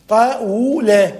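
"طول" slowly as ta-wu-la, with a consonantal w; underlying past form. Shown as a demonstration. Teacher showing the original past verb, "طول" slowly as tawula. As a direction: stretch "طول" into three syllables, ta-wu-la. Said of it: "فأصل الفعل المتقدم ماضي ومضارع قبل إعلال".

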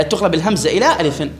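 ordinary talk, nothing marked

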